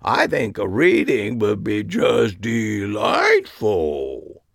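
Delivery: slow, drawn-out mock posh voice; mocking impression of Harry. Mocking Harry's fake enthusiasm, slow drawn-out impression. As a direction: stretch the last syllables out long. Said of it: "I did my best impression of him".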